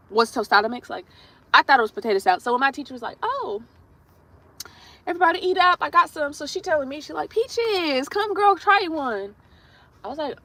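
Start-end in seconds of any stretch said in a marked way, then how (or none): 3.2-6.3 high, inviting teacher voice; imitated teacher. Acting out the teacher offering food. 7.3-9.3 high, drawn-out coaxing imitation; coaxing teacher. Acting out the teacher coaxing her.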